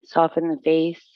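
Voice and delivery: low, even meditation voice with long pauses; guided relaxation. Real, not acted. Yoga teacher guiding students into breath and stillness, calm guided relaxation.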